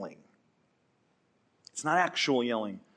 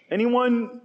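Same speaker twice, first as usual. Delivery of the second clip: higher, louder hammed-up question that falls at the end; mock robber call; 9 semitones higher, 5 dB louder than usual. Robber asking the crowd for volunteers, falling mock call. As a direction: toss it out to the crowd, half-expecting no takers. Robbers recruiting in city gates.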